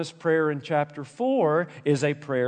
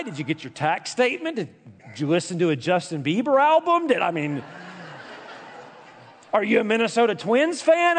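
normal talking voice

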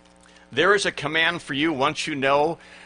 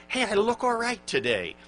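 pleased with yourself; 8 semitones higher than usual. Acted. Mirror self-talk acted out.

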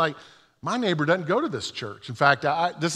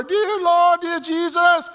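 high, loud hammed-up church lady praying; high, loud mock preaching voice; church lady prayer; 10 semitones higher, 4 dB louder than usual. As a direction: mimic a fervent church lady in full flow. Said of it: "praying this really powerful prayer".

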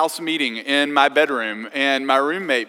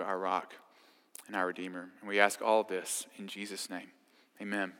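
soft, lower prayer voice; 6 semitones lower, 6 dB quieter than usual. Real, not acted. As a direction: pray it quietly and humbly. Leads a closing prayer.

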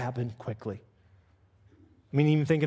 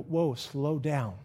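very slow, soft calming words; soft, drawn-out reassurance; gentle slow down; 2 semitones lower, 4 dB quieter, slower than usual. Retold or imitated. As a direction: ease them down like calming a rushing friend. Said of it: "Jesus is gonna go".

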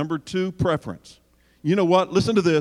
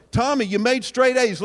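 gushing praise; 7 semitones higher, 4 dB louder than usual. Acted. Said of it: "How do you reward that report card?".

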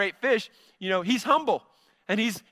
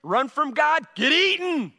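put-on bright, rising kid-teacher voice, louder at the end; Sunday school teacher; 6 semitones higher, 3 dB louder than usual. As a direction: pitch it up like a cheery teacher to children. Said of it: "telling kids in Sunday school".

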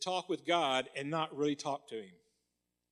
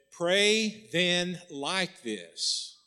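deliberate stress on Pray then like this; slow emphatic; much slower than usual. Shown as a demonstration. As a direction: Pray then like this, each word slow and weighted. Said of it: "Jesus did not say".

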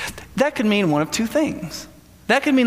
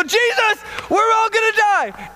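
much higher, frantic cry; panicked wail; 13 semitones higher, slower than usual. Retold or imitated. Terrified disciples waking sleeping Jesus, panicked wail. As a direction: shriek it like they're sure they'll sink.

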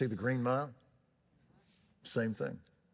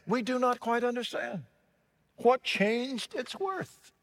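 crowd crying out; 13 semitones higher, 4 dB louder than usual. Acted. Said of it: "some of them cried".

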